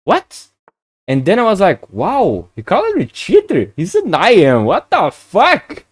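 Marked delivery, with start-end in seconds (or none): 0.0-0.5 sharp high squeak of disbelief; a jolt of shock on one word; stunned what. Retold or imitated. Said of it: "at first I feel like". 1.9-5.8 high, outraged disbelief, slowing at the end; can't believe he's called a cheater; indignant disbelief. Retold or imitated. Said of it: "first I get instantly mad".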